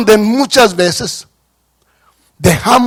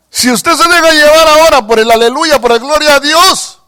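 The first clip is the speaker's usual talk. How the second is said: raised, intense preaching; fervent preaching; 8 semitones higher, 4 dB louder than usual. Acted. Pastor warning the church, fervent.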